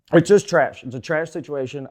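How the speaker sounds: loud angry snap; fed-up fan yelling trash; angry outburst. Real, not acted. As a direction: spit out the verdict in disgust. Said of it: "I'm mad at the situation".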